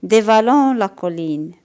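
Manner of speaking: ordinary delivery, nothing marked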